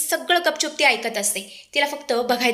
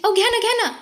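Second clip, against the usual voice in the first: insistent coaxing; 10 semitones higher, faster than usual. Acted. Daughter-in-law urging grandmother to drink lemonade, insistent coaxing. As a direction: voice climbs high on a wheedling plea.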